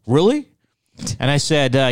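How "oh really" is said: high, louder mock-doubtful reply; skeptical. Mountain man doubting it's all over internet, skeptical. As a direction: imitate a doubter, pitch shooting up.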